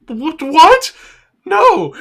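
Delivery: high, rising yelps into a loud protest; mock outraged squawk, shooting up in pitch; shocked disbelief. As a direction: blurt it out in high-pitched alarm. Coach reacts to fake arrest.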